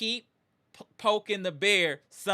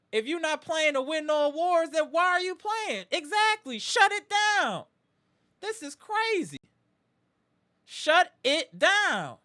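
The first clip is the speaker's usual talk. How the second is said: very high, incredulous cry; exasperated outburst; 11 semitones higher, 3 dB louder than usual. Real, not acted. Host demanding team shut star down, exasperated outburst. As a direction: push the pitch way up, drag out the final words.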